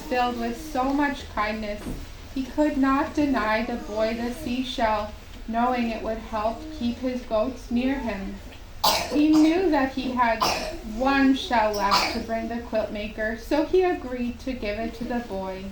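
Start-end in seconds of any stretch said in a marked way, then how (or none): none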